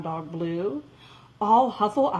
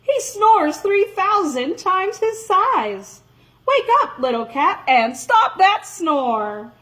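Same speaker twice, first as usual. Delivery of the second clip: loud call; 8 semitones higher, 4 dB louder than usual. Acted. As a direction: call out loud to wake her. Jack calling the kitten.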